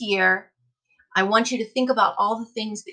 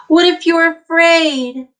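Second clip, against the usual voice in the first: high, loud anxious question; worried question; 7 semitones higher, 8 dB louder, slower than usual. Acted. Teacher voicing a child's doubt, high and anxious.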